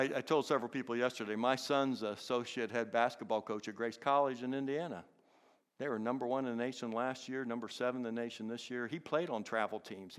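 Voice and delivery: ordinary delivery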